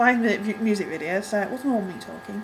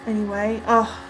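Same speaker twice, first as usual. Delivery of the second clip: usual speaking voice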